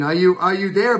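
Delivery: ordinary delivery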